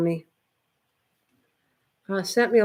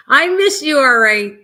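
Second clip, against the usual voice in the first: high, loud gushing; excited to the dog; 6 semitones higher, 10 dB louder than usual. Real, not acted. Telling the dog she misses her, loud and high. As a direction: gush over the dog through laughter.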